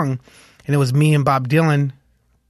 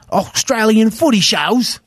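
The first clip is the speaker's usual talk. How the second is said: higher, slow, hammed-up Australian accent; horrible Australian accent; 8 semitones higher, slower than usual. Musician listing shows played before, bad Australian accent. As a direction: put on a bad Australian twang. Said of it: "That was a horrible Australian accent again".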